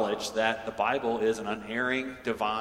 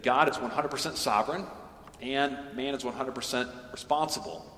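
put-on light Dutch accent, raised pitch; Doctor K Dutch accent; 4 semitones higher than usual. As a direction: quote the professor in a Dutch accent. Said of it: "in his wonderful Dutch accent".

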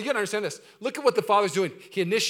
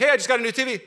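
loud, higher, eager dad cutting in; excited dad interrupting; 8 semitones higher, 11 dB louder, faster than usual. Acted. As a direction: burst in with happy news. Dad cuts in on confession.

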